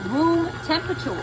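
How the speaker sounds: ROOM TEMPERATURE pushed high and loud; emphasized room temperature. Shown as a demonstration. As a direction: lean hard on room temperature. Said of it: "I'm gonna emphasize it".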